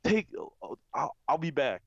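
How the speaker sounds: high, jolted reply; startled. Retold or imitated. Answering the call, suddenly startled. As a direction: jump at the call.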